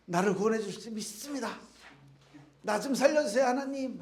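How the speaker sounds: desperate prayer. Acted. Man praying desperately as water rises.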